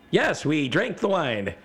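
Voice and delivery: high, slow, emphatic reply; exasperated mock player voice; insistent players. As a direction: insist gamely, stretching the words. Players answer impatiently.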